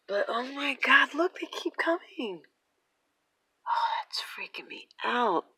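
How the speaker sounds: whispered, breathless unease; uneasy whisper. Real, not acted. Watching the endless flock, whispers that it frightens her. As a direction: whisper it as if the birds might hear. Hushed while watching the birds.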